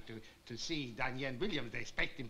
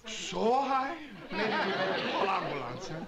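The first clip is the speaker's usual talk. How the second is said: alarmed; 5 semitones higher, 6 dB louder than usual. Acted. Alarmed at fever reading.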